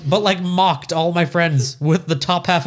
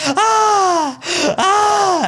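high, loud mock screams; mock screaming cries; 4 dB louder than usual. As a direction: shriek like on a roller coaster. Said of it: "she's going like".

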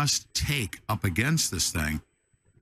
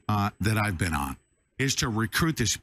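ordinary delivery

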